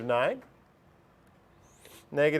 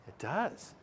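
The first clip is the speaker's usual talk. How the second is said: ordinary delivery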